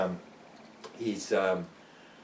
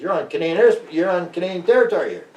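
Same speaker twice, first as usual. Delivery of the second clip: raised, high, outraged insistence; indignant protest; 8 semitones higher, 5 dB louder than usual. Retold or imitated. Investigator to American soldier, indignant raised voice. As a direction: stand your ground, voice climbing high.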